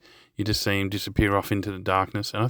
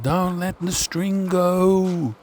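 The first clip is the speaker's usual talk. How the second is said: imitated far-off call, much higher and a bit louder, drawn out slowly; 11 semitones higher, 4 dB louder, much slower than usual. Cobra calling from the flooded drain to his friends, an imitated drawn-out high call. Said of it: "he's calling out".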